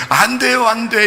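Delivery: mock protest. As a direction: cry out no like a frantic disciple. Peter protesting, imitated.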